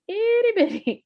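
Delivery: high, loud squeal on tiny words; squeaky emphasis. Real, not acted. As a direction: squeal it to show how tiny. Stressing how tiny the room is.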